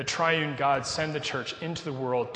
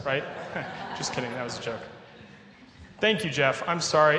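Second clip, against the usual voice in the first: ordinary talk, nothing marked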